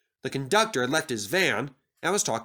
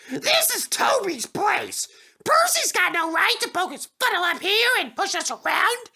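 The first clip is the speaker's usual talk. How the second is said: grumbling freight cars; 4 dB louder than usual. Acted. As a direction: grumble like sulky trucks. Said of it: "grumbled to each other".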